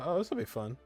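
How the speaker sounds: ordinary delivery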